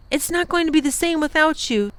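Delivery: put-on a child sorry to say goodbye; wistful girl. Lindy tells the professor she'll miss him, wistful.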